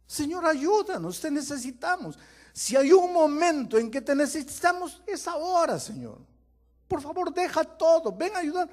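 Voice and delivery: high loud urgent cry; crying out to God. Acted. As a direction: call out like a whole people in need. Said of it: "Era el clamor del pueblo".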